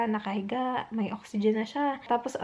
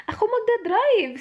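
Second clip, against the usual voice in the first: insistent offer; 10 semitones higher, 5 dB louder than usual. Retold or imitated. Insisting to stubborn husband.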